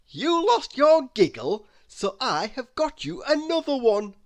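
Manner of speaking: proud of the plan; cheerful. A narrator's reading. Mister Happy explains the present, cheerful.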